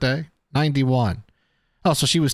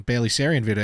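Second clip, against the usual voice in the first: usual speaking voice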